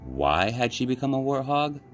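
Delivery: ordinary delivery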